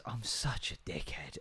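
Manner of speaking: put-on soft, slow, hushed little-girl voice; quiet, slow imitation of his daughter; toddler quote. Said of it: "But now she goes".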